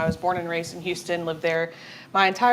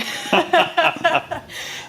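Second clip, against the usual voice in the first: bright burst of real laughter; 11 semitones higher, 5 dB louder than usual. Real, not acted. Guest laughing at her own reversal on Sam Houston. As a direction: laugh at yourself, amused.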